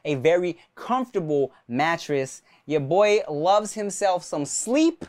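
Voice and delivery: relaxed, friendly sponsor read. Acted. Host reading a mattress ad to listeners, relaxed. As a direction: sell comfort easygoing.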